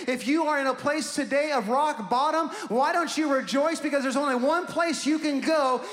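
rising to the call; preaching at full force. Real, not acted. Pastor urging the congregation to rejoice, shouted sermon.

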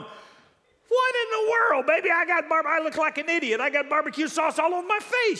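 high, loud outburst of disbelief; hammed-up, indignant yelling at your wife; exasperated husband. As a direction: shoot up high in loud dismay. Husband acting out shocked discovery.

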